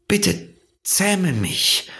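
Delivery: very slow, high plea; pleading. A narrator's reading. Fox begs the little prince to tame him, pleading. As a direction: draw it out as a begging fox.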